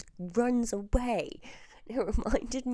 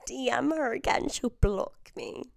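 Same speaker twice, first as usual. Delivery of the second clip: ordinary delivery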